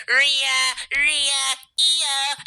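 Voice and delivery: high, loud sing-song calling out; imitating Liv Morgan yelling for her allies; mock Liv Morgan. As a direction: call out names in a whiny high voice. Mocking Liv Morgan again.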